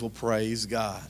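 resigned praise. Retold or imitated. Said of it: "He says".

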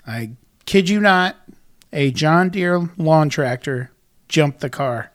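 ordinary delivery

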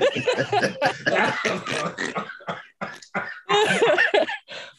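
squealing high laugh; shrieking laugh. Real, not acted. Group cracks up at word.

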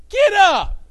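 loud, high stern command; parent scolding. Retold or imitated. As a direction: bark it like a fed-up parent.

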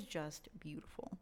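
hushed whisper of admiration; soft awed whisper; whispered awe. Real, not acted. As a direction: whisper it, almost reverent. Admiring the character's story.

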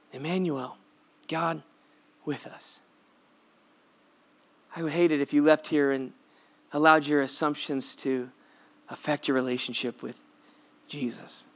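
soft, low closing appeal; hushed appeal. Acted. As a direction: let it drop to a hush.

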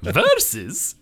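high, loud, slow disbelieving game voice, laughing; incredulous Mario. Retold or imitated. Mocking Mario's reaction to the versus option, high loud incredulous laughing voice. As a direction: act appalled that anyone picks versus. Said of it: "he can't believe that".

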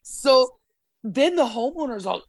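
normal talking voice

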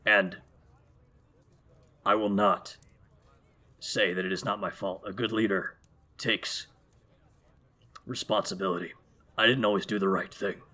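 quiet, grave royal resolve; solemn king. Acted. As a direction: speak as a deposed king reclaiming his throne. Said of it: "He shakes his head".

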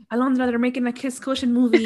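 gasping, loud teasing news; spring scary news with a gasp; mock shocked announcement. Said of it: "We should try to scare you".

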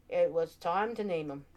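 ordinary delivery, nothing marked

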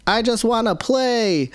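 put-on high, slow whine of a kid; whining child. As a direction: whine it like a kid who only wants to play. Child's whining voiced by dad.

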